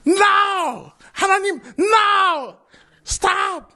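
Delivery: high, crying shout of no, hammed-up; overacted refusal. Moses refusing God, hammed-up high wailing shout. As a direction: wail in panicked refusal, over the top. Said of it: "약간 이게 오바를 하고 있습니다".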